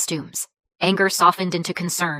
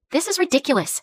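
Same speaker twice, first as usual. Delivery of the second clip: higher-pitched irritated snap; snapped; 7 semitones higher than usual. A narrator's reading. Mother confronting bank staff in the lobby, snapping. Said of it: "she snapped".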